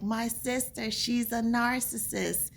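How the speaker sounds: lightly voiced coworker impression; imitating coworker. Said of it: "that's how she talks, by the way".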